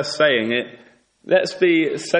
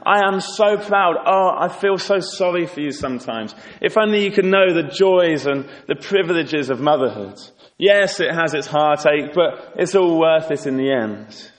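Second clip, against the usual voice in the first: higher, gushing, boastful rival; excited gloating; 4 semitones higher than usual. Acted. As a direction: gush with pride over her child to wound. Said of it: "coming in all excited".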